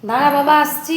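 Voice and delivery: loud, high call across the house. Real, not acted. Calling out to child from afar.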